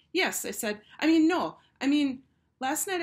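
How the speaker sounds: high, loud panicked self-correction; flustered blurt. A narrator's reading. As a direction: scramble to fix the slip. Flustered girl corrects herself.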